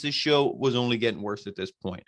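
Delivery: ordinary talk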